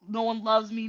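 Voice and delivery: ordinary talk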